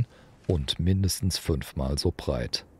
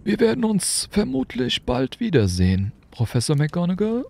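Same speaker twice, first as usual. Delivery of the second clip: put-on high, kindly old wizard, lightly curious; an amused old headmaster asking; Dumbledore. A narrator's reading. Dumbledore questioning Hagrid about the motorbike, amused.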